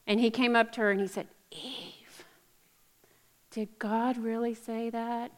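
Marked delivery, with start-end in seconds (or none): 1.5-5.3 soft, sly tempter; hushed, coaxing serpent. Retold or imitated. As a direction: lure her in quietly like the serpent. Serpent tempting Eve in retelling.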